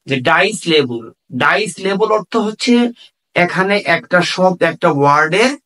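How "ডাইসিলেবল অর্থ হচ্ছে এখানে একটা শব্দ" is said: usual speaking voice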